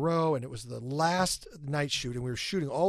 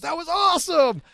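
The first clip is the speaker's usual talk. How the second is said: much higher, loud burst of praise; excited shout; 14 semitones higher, 9 dB louder than usual. Retold or imitated. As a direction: yell it like a thrilled fan. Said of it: "Tom jumped up and goes".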